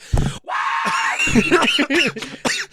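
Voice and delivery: high wordless scream; deliberate loud yell. Shown as a demonstration. Co-host making the recording clip on purpose, all-out yell. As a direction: yell to overload the microphone. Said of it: "[name]'s about to yell as loud as he can".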